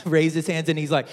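ordinary delivery

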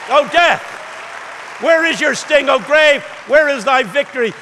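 high, loud, exultant sermon shout; full-force preaching. Real, not acted. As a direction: proclaim victory over death to the whole church.

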